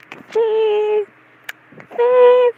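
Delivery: hammed-up high-pitched begging; squealed plea. Re-enacting plea, escalating to squeal.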